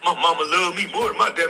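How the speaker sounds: normal talking voice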